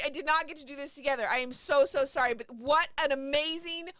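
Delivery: bubbling over with excitement; thrilled. Real, not acted. Streamer telling her viewers about the win, thrilled.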